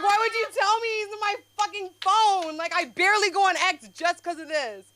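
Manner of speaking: high, loud upset protest to her dad; outraged wail. Retold or imitated. Telling her dad about Elon Musk, high outraged wail. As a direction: cry out at him in disbelief. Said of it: "I was like".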